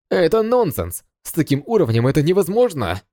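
high, stunned disbelief; shocked. Acted. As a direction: cry out in shock. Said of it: "Игроки были в шоке".

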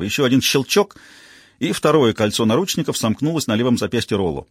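plain reading voice

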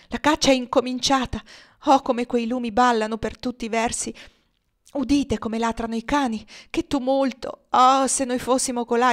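high, loud, breathless alarm; frightened excitement. Acted. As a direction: cry out at the torches and dogs. Emmelina panics watching hunt. (Emmelina guarda la caccia, eccitata e spaventata, voce alta)

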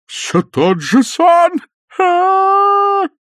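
high, slow, loud operatic howl; old man wailing in horror; terrified wail. A narrator's reading. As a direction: howl in horror at the recurring dream. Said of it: "с шаляпинскими интонациями".

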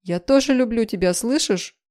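high, loud declaration of love; urgent, pleading cry; desperate shout. A narrator's reading. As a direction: shout it so they surely hear. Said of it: "закричала я".

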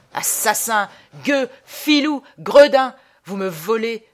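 volley of loud insults; furious howl. Acted. Robbed traveller hurling insults at mourners, furious howl. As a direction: yell each insult as its own blow. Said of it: "hurlai-je".

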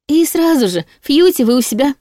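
high, chirpy assurance; eager promise. A narrator's reading. Heroine promises to send demon home instantly, eager. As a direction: pitch it up high and breezy.

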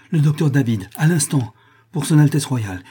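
high, loud summons; urgent calling out. A narrator's reading. Aide at the door summoning the doctor, urgent. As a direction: call out for help at once.

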